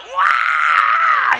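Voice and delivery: put-on high, loud baby cry; newborn wail. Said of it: "He came out".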